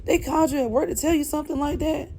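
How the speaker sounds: high, fast, disbelieving supervisor; stunned boss, voice shooting high; retold shock. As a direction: pitch jumps up in outraged disbelief. Supervisor hears shocking news.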